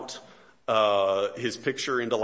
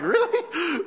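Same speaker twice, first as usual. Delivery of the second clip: mock disbelief; 5 dB louder than usual.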